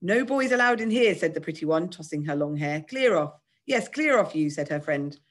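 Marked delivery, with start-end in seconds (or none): 0.0-1.1 put-on high, loud indignant girl; raised voice, haughty outrage; outraged. A narrator's reading. 2.9-4.5 high, indignant dismissal; two affronted girls ordering someone out; outraged. A narrator's reading.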